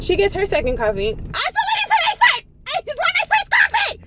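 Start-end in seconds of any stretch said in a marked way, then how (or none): 1.1-3.9 very high-pitched frantic squeal; exasperated outburst. Acted. Waiting customer venting over her first coffee, exasperated. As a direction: squeal it in mock outrage.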